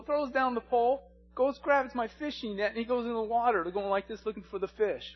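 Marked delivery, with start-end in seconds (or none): none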